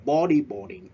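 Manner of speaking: BOdyboarding punched high and loud; stress on bodyboarding. Shown as a demonstration. Teacher drilling class: first syllable stressed. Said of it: "we stress at the first syllable".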